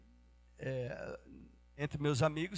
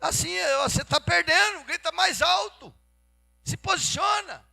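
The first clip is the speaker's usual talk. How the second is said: high, loud goading voice; devil egging someone on; 13 semitones higher, 8 dB louder than usual. Acted. Devil goading a quarreller to yell louder, pushy and high. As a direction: urge them on, pitched well up. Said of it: "O diabo entra e fala assim".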